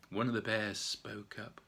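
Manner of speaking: ordinary delivery, nothing marked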